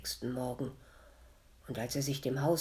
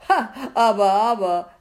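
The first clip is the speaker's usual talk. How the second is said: loud, higher scolding call; tadelnder Ruf; 6 semitones higher, 16 dB louder than usual. A narrator's reading. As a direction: chide loudly in a raised voice. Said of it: "rief er tadelnd". (laut tadelnd)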